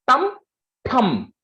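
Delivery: stressed tum syllable; emphasized tum. Shown as a demonstration. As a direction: hit the tum with weight.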